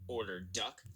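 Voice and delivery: plain reading voice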